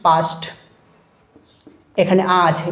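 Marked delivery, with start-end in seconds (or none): 0.1-0.4 পাস্ট with a smooth, open "aa"; normal আ. Shown as a demonstration. Teacher showing students the normal আ, smooth open vowel. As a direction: say পাস্ট with a plain full আ. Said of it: "আ দিয়ে যদি আমি কিছু word বলি".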